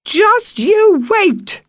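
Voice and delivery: very high, loud, slow shriek; shrieked threat. Acted. Megan shrieks a threat after the fleeing girls, high and loud. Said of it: "shrieked the woman after them".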